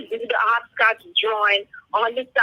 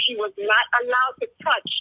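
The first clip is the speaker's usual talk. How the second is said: usual speaking voice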